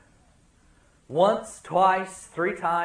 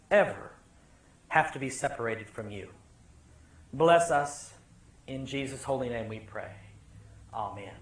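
prayer; 5 semitones lower, 3 dB quieter than usual. Real, not acted. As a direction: pray it softly, reverently. Opening prayer.

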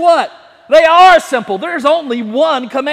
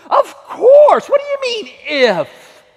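much higher, startled of course; mock disbelief; 10 semitones higher, 6 dB louder than usual. Preacher playing a startled believer, much higher. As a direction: squeak it out in disbelief. Mock indignant reply to Jesus.

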